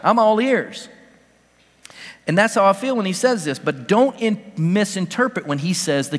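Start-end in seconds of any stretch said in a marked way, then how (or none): 0.0-0.9 eager curiosity. Retold or imitated. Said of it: "I'm like".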